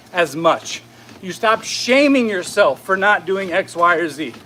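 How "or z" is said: ordinary talk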